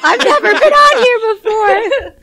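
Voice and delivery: high, slow voice cracking. Real, not acted. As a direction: hungover voice cracking with laughs. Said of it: "my voice cracked from being drunk".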